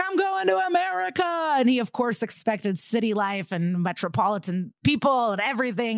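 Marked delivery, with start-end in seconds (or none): 0.0-1.5 mock excitement. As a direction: imitate him gleefully announcing it way up high. Acts out his excited arrival.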